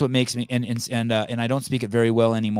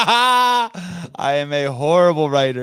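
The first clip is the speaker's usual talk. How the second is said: laughing through the words, higher and louder; 8 semitones higher, 7 dB louder, slower than usual. Real, not acted. Host laughing at the guest's jab about his emails, real laughter. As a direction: laugh it out, half amused by the insult.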